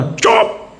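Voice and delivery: put-on high, sharp "shush" to a baby; imitating a parent's sharp hush; mock scolding. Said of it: "उसको डांटोगे ना".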